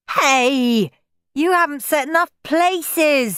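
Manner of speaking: high, loud protest; indignant child. A narrator's reading. Henry complaining about missing places, indignantly. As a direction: object loudly in a child's voice. Henry objects to the table setting.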